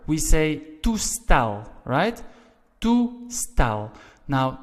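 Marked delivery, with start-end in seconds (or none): 0.8-1.7 "toestel" slowly, with the weight on STEL; stressed last syllable. Shown as a demonstration. Teacher contrasting Dutch endings for learners, last syllable of "toestel" stressed. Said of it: "the last E L is stressed". 2.8-3.9 "Toestel" in two slow parts, full weight on STEL; stressed last syllable. Shown as a demonstration. Teacher repeating the example for learners, final syllable of "Toestel" stressed. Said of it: "the last E L is stressed".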